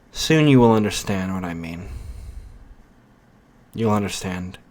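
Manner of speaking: portentous storyteller, letting each phrase hang; ominous narration. A narrator's reading. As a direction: intone it like a foreboding prophecy.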